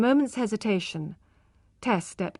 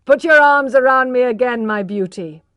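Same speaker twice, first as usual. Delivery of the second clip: teasing; 3 semitones higher, 10 dB louder than usual. A narrator's reading. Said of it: "he said, laughing".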